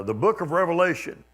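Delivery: mocking preacher. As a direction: imitate a preacher announcing it mockingly. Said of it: "He was kind of mocking".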